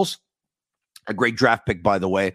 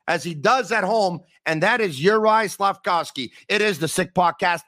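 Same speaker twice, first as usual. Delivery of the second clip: upbeat, higher hockey-show opener; show intro; 9 semitones higher than usual. Acted. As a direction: sell the night's highlights with energy. Energetic show opening recap.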